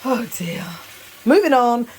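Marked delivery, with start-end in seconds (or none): 1.2-1.9 loud, high exclamation. Real, not acted. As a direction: announce it brightly. Loud brisk change of topic.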